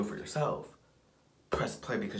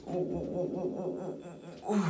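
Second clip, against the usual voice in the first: high shivery oohs; cold-water oohs; 9 semitones higher than usual. Retold or imitated. Imitating reaction to cold water.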